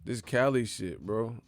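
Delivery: usual speaking voice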